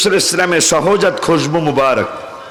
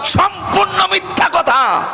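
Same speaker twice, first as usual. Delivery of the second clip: high, drawn-out shout of rebuttal; soaring preacher's denunciation; forceful denial; slower than usual. Real, not acted. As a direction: cry it out slowly and emphatically. Forceful denial of opponents' claim.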